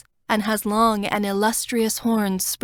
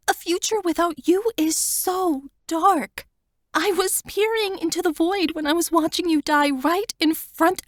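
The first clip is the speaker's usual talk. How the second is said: higher, tearful plea to her friend; Wanda's voice; 8 semitones higher than usual. A narrator's reading. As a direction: voice her pleading, higher and fuller. Wanda confessing her fear.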